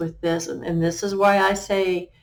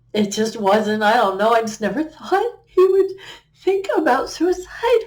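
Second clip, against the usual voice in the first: real grief cracking into a tight, strained laugh; voice breaking up; 7 semitones higher, 5 dB louder than usual. Real, not acted. Mother recalling her son's suicide, voice breaking high. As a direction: pitch climbing high and louder as emotion breaks through.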